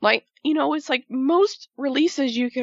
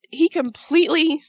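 usual speaking voice

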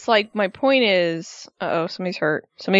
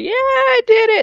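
much higher, louder, proud mock boast; dazed triumphant AJ Styles; 5 dB louder than usual. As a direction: play the wobbly, proud champion holding his title. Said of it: "holding up his title like".

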